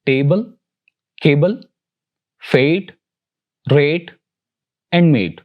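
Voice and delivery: "table, cable, fate, rate and mate" with a long "ay". Shown as a demonstration. Teacher giving another sound of A, long "ay" in "table, cable, fate, rate and mate". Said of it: "जो कि ए है".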